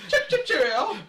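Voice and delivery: high, loud cartoon British accent; mock British accent. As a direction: ham up a silly British accent. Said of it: "give her a British accent".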